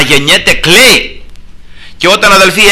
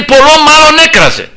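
high, emphatic preaching; fervent insistence; 11 semitones higher, 3 dB louder than usual. Retold or imitated. Preacher tells how the blind man kept crying, high fervent delivery. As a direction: drive it home at a raised pitch. Insisting he cried out all the more.

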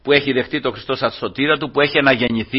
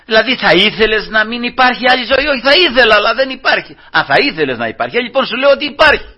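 fervent preaching; 7 semitones higher, 3 dB louder than usual. Acted. Preaching at full force.

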